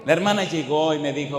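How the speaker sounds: ordinary delivery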